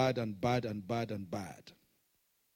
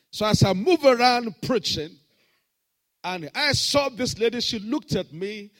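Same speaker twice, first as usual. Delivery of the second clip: louder, higher story telling; preaching; 9 semitones higher, 12 dB louder than usual. Acted. Pastor telling the congregation a story, raised. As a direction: drive the story forward.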